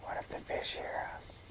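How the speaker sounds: playful conspiratorial whisper. Pretend. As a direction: hush it like a secret. Keeps whispering about fish.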